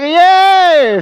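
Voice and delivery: a hammed-up little yay; mock cheer. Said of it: "We're like".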